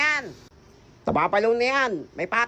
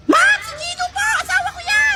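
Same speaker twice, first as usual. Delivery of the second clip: very high eager squeal; excited fangirl; 14 semitones higher than usual. Acted. Girl nominates her crush to the teacher, squealing.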